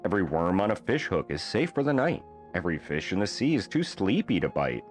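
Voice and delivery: plain reading voice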